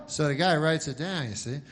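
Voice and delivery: ordinary talk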